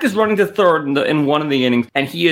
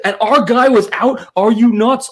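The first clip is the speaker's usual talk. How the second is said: louder, higher mock rant at the ump; retold outrage; 6 semitones higher, 4 dB louder than usual. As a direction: rant at the umpire, half-replayed. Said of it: "Like, I went nuts".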